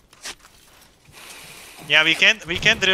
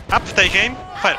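shouted command; 5 semitones higher than usual. Real, not acted. Shouting line commands in battle.

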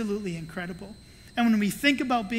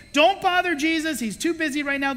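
louder, higher brush-off; stern disciples; 6 semitones higher, 5 dB louder than usual. Retold or imitated. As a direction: shoo people away. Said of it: "what their minds were like".

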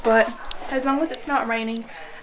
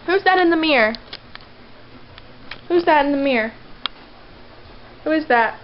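pet talk; 4 semitones higher, 4 dB louder than usual. Real, not acted. Owner asks her dog about the mirror, high and loud. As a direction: coax the dog in a sing-song voice.

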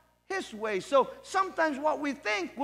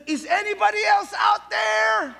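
high, loud yell for anyone else; man ignoring God's answer and yelling; shouting; 8 semitones higher, 8 dB louder than usual. Acted. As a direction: holler past God for other help.

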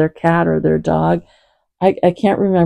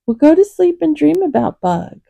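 high-pitched pet-talk to a cat; kitty voice; 10 semitones higher than usual. Real, not acted. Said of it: "Sorry for my kitty voice".

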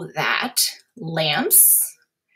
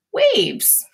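high-pitched flourish; 4 dB louder than usual. Shown as a demonstration. Teacher showing how vibrations move, high swooping word. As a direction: sing out WAVES high, then let it fall. Acting out waves for class.

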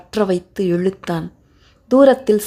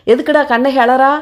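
higher, louder query; irritated policeman; 6 semitones higher, 5 dB louder than usual. A narrator's reading. Policeman asks why the woman cries, irritated demand. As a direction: demand an explanation.

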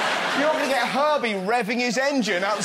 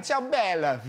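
lightly put-on Italian "Ciao bella"; a hint of Italian charm; mock Italian accent.

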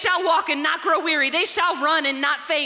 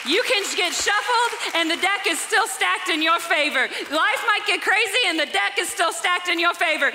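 louder list of God's names, rising; building praise litany; 3 semitones higher, 3 dB louder than usual. Acted. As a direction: pile up the names like a crescendo.

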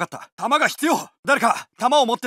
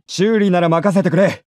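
the same offer, louder; louder version of the offer; louder take; 7 semitones lower, 5 dB louder than usual. Acted. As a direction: call it out over the noise.

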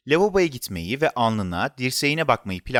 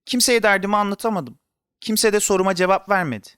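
put-on high-pitched child's grumble; complaining; 6 semitones higher than usual. A narrator's reading. Boy whose question got no answer, frustrated. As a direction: whine that nobody listens.

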